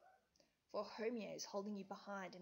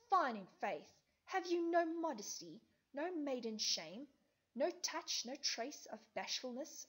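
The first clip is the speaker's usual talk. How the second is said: angry Helena; 4 dB louder than usual. Acted. Helena berating Hermia, loud and indignant. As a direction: scold her with wounded pride.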